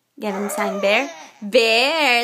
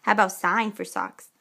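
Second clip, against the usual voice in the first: ordinary talk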